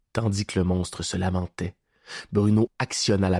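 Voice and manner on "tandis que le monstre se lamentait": plain reading voice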